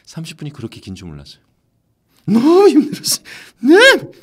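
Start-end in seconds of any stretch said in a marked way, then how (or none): 2.3-4.1 very high, very loud cartoon yelling; strained character voice. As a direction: belt it out in a piercingly high character shout. Re-enacts the exhausting character recording.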